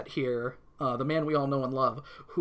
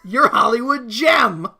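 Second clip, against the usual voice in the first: gushing fan; 6 semitones higher, 10 dB louder, slower than usual. Retold or imitated. Voicing Rhea's line.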